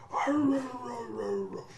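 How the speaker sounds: very high squeaky barking; high dog barks. Retold or imitated. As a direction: bark like a dreaming puppy. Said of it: "he's like".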